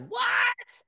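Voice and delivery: high shouted disbelief; shocked what. Retold or imitated. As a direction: yell it up high in total surprise. Buddy shocked he finished reading.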